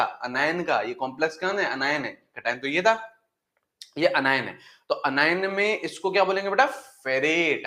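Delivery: ordinary talk, nothing marked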